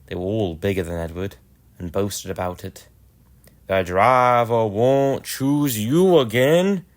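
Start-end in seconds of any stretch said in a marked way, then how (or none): 3.7-6.9 slow, louder boastful sneer; big engines taunting. Acted. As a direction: lord it over the little engine, drawn out. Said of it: "they said".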